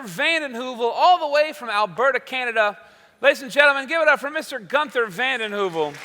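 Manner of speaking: loud showman build-up to a name; announcer intro. Acted. Host brings the guest on stage, announcer style.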